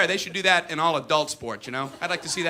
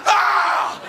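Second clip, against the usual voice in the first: loud, high scream of fright; terrified yelp; 7 dB louder than usual. Acted. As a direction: scream as a fastball grazes your head. Ball grazes batter's head.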